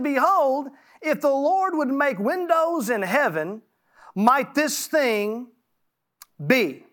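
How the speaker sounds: solemn pulpit reading; scripture reading. Acted. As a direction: proclaim the verses from the pulpit. Reads scripture aloud.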